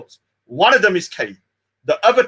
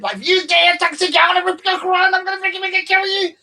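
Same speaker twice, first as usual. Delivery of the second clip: high, mocking imitation of her rant; high mock voice of the woman he's ridiculing; 11 semitones higher than usual. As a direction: parody her as a raving extremist, pitched way up. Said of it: "Like, she's the extremist".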